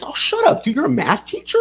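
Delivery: mock amazement. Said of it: "I was like".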